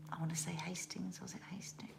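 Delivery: soft unsure whisper to herself; breathy half-whispered aside. Real, not acted. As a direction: drop to a whisper while checking the name.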